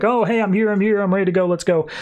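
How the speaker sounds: retold eager return; mock breezy hurry. Babs shows back up at the wedding, mock eager and hurried.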